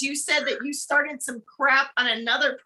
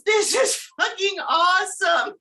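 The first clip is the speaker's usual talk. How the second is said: excited shout; 6 semitones higher, slower than usual. Retold or imitated. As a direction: yell it like the best news ever. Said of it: "he just starts laughing hysterically".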